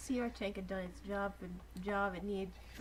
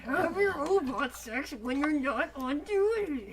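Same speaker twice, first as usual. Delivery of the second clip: mock stern leader; 6 semitones higher, 9 dB louder, slower than usual. Voicing Optimus scolding.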